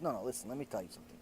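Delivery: quiet aside, just between us; hushed confiding. Retold or imitated. Man confides to his friend outside, low and hushed. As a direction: drop the voice low and soft, letting the friend in on a secret.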